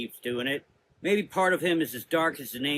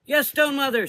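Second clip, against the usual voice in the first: much higher, louder eager reply; young sister; 13 semitones higher, 8 dB louder than usual. A narrator's reading. Young sister answering Mother Azra, eager.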